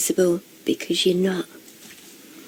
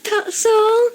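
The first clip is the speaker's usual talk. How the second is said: laughing. Real, not acted. Cracking up at the comment.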